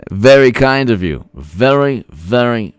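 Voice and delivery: ordinary delivery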